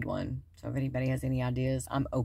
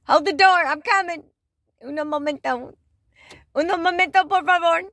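loud, high-pitched mock hollering; playful calling out; 10 dB louder than usual. Asking them to hold the door, hammed-up calling out. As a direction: call across the lot in play. Pretends calling to store to wait.

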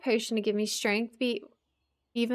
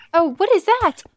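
much higher, loud panicked cry; startled shriek; 12 semitones higher, 10 dB louder than usual. Real, not acted. Player startled by something appearing, high loud shriek. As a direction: shriek at the sudden threat. Sudden scary thing appears.

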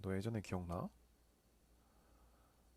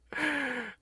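loud high delighted laugh; burst of laughter; 6 dB louder than usual. Real, not acted. Friend's good news on phone. (높고 크게 터지는 웃음)

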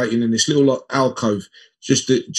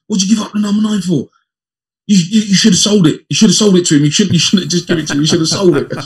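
higher and louder, a mocking dig at a teammate; high, loud mock scolding from a mate; teasing teammate; 7 semitones higher, 5 dB louder, faster than usual. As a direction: rib him like a cheeky teammate. Teammate Lee Clark mocking Les.

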